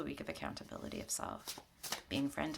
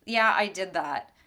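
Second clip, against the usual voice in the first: louder, sudden admission of a mistake; owning it; 10 dB louder, much slower than usual. Retold or imitated. Imitating someone who admits a mistake, louder and sudden. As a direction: blurt it out as if just caught. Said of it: "or you're like".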